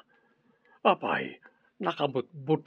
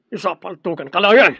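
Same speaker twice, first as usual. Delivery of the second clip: angry threat; 4 semitones higher, 8 dB louder than usual. Acted. Boss threatens to shoot.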